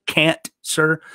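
"can't, sir" in two detached words; split as can't-sir. Shown as a demonstration. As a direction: say cancer as "can't, sir", pausing after the T. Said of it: "I would say".

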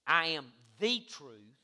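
slow declaration leaning on "the"; stress on THE. Shown as a demonstration. Not a way.

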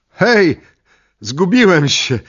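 high, loud call across the field; shout. Acted. Lost man calls to the camper, loud high shout. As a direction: yell out to be heard from afar. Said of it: "krzyknąłem".

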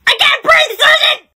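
yelling out of breath; breathless shout. Acted. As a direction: gasp and shout her name. Gasping out, calling a friend.

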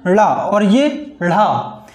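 ला and ढ़ा spoken very slowly, each sound drawn out; slow teacher's demo of ला and ढ़ा; careful demo pronunciation. Shown as a demonstration. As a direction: model ला and ढ़ा slowly for students. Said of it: "इसको बोलते हैं".

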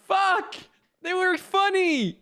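high-pitched, drawn-out whine of regret; exasperated high cry; regretful wail. Real, not acted. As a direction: wail it high, mourning the lost company. Lamenting lost game companions.

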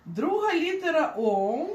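ordinary talk

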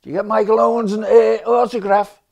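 high, loud imitation of her question; sister-in-law's voice. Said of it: "Holly, my sister-in-law".

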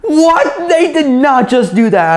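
high, loud imitated cry of disbelief; shocked disbelief. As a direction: play the stunned stranger in the seat next to you. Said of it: "he was like".

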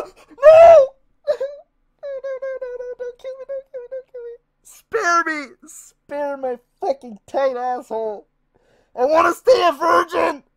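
high, crying plea; sobbing pleading for mercy; panicked begging. Real, not acted. Begging monster not to kill.

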